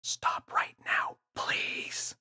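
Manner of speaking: slow urgent whisper. A narrator's reading. James begging the gorilla in a whisper, slow. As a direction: hiss it out slowly, pleading.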